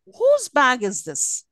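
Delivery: slow wh-question falling on "this". Shown as a demonstration. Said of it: "examples of falling tones".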